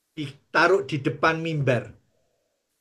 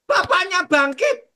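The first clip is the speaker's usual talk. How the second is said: higher, slow amazed cry; excited announcement; 12 semitones higher, 4 dB louder than usual. Retold or imitated. Woman tells the pastor the dead man rose, excited cry. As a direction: announce the miracle breathlessly.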